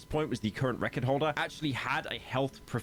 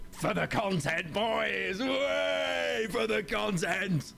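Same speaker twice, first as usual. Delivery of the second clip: mock battle cry; 7 semitones higher, much slower than usual.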